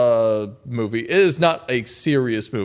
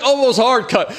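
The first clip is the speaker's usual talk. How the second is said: ordinary delivery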